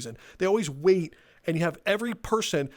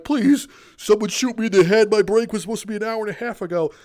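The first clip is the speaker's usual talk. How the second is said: loud, higher mock-desperate pleading; exasperated plea; 4 semitones higher, 6 dB louder than usual. Employee stuck with rambling customer, loud mock-desperate plea. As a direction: beg like a worker at the end of their rope.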